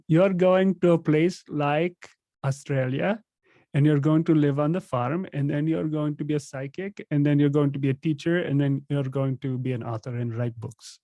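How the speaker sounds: put-on fortune teller in an Indian accent. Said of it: "I'll do my best Indian accent".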